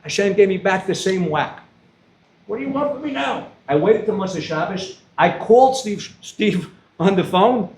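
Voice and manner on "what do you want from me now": high, hushed plea; exasperated appeal in a small high voice; pleading. Retold or imitated. Man in pain asks Hashem what He wants, pleading.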